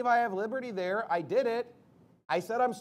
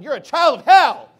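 full-force shout, much louder and higher; acted yell; 7 semitones higher, 18 dB louder than usual. Preacher acting out saying it to someone today, shouting. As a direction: yell it at them as a fierce rebuke. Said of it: "when he's yelling at the Pharisees".

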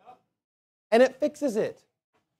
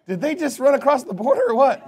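teasing joke; 6 semitones higher than usual. Retold or imitated. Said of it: "they're just like".

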